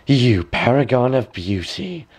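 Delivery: a touch of a sneering hag; mock her lightly as the wicked queen; lightly voiced gloating villain; wicked woman. A narrator's reading. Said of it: "said the wicked woman".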